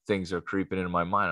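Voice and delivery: ordinary talk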